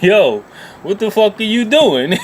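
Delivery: high, loud, shocked yell. Retold or imitated. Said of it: "I was just like".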